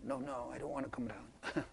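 very soft, small scared voice; little child afraid of the monster; frightened child. Retold or imitated. Child refusing to look under bed, hushed and scared.

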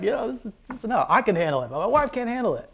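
high, loud, overwrought plea; hammed-up pleading, pitch swooping up; pleading protest. Husband tells God his wife can't handle it, pleading protest.

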